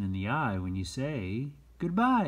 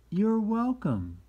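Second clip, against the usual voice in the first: modeled reply; 12 semitones higher, 7 dB louder than usual. Shown as a demonstration. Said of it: "is what we say back".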